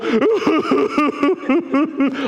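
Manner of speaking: high wailing boo-hoo sobs; mock weeping. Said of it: "I just weep".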